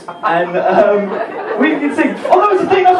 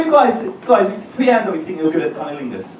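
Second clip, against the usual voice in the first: ordinary talk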